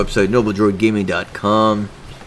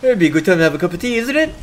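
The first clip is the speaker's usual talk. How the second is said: hammed-up British asking a question, rising high on isn't it; mock British; 6 semitones higher, 4 dB louder, faster than usual. Friend mocking British tag questions, hammed-up British. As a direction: imitate a Brit confirming himself. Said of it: "I like the British ones".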